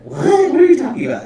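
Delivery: high, loud disbelieving "what"; what on earth reaction; incredulous outburst. Real, not acted. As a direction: burst out baffled, pitch leaping up then falling. Reacts in disbelief to reporter's line.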